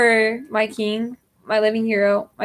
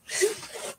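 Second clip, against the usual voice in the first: teary laugh; 11 semitones higher, 9 dB quieter than usual. Real, not acted. Breaks down mid love message.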